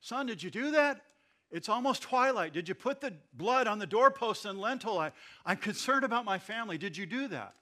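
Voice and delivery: put-on high, loud anxious old father pleading; worried grandpa. Grandpa urgently asking his son about the blood, high and loud. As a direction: fret over the family like a scared grandpa. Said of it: "as Grandpa says".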